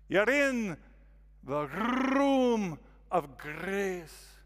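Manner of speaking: loud Scottish burr on a drawn-out "grace"; Scottish or Irish accent. Acted. Preacher proclaims the room of grace, Scottish brogue. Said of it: "can't say it except for in Scottish or Irish".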